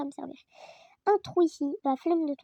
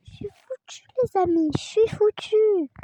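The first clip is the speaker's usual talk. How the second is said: dramatic despair; 5 semitones higher, 8 dB louder than usual. Real, not acted. Despair before monster.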